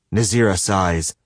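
plain reading voice

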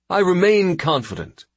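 a self-assured fleet admiral; confident admiral. Acted. Admiral voiced by narrator.

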